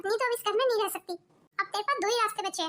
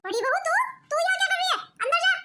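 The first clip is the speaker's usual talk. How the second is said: high, loud scolding order; angry shout; 7 semitones higher, 3 dB louder, faster than usual. Acted. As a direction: bark at her to get inside. Mother-in-law scolds elder daughter-in-law. (गुस्से में ऊँची आवाज़ में डाँटना)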